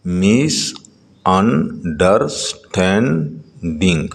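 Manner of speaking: مس، انڈرسٹینڈنگ very slow, each syllable apart; teacher sounding out مس، انڈرسٹینڈنگ bit by bit. Shown as a demonstration. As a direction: stretch and separate every syllable of مس، انڈرسٹینڈنگ. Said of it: "Read the following multi-syllable words".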